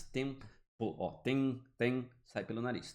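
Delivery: "Tempo", then "tem, tem" stressed, vowel out through the nose; nasal vowel. Shown as a demonstration. Teacher demonstrating nasal sound to students. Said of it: "faz sair um pouco de som pelo nariz".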